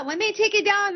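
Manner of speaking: normal talking voice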